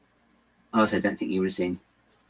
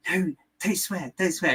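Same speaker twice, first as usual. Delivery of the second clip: higher mock scolding voice; imitated telling-off; 9 semitones higher than usual. Retelling a friend's reaction on a ride, high-voiced mock telling-off. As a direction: mimic someone hushing the swearing, pitched up. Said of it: "And it was like".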